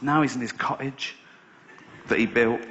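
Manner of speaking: ordinary delivery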